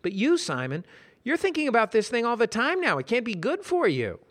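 high concerned voice. Acted. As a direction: worry for him aloud. Brad concerned.

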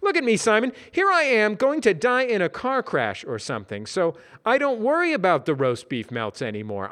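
high, loud cheerful voice; Brad voice. Acted. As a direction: preach contentment cheerfully. Brad speech.

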